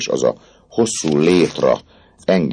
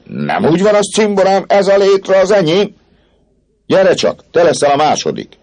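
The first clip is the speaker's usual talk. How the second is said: high excited shout; 7 dB louder than usual. Acted. Jean claims the ladder.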